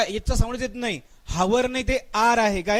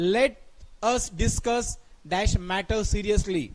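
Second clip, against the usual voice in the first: very slow, word by word reading; slow dictation; much slower than usual. Shown as a demonstration. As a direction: read it out slowly for the class. Teacher reads question slowly. (सावकाश वाचन)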